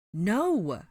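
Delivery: loud, high denial; indignant shout. A narrator's reading. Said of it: "Hermione shouted indignantly".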